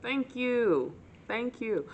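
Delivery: tight-lipped smile. Retold or imitated. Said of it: "with a very tight-lipped smile".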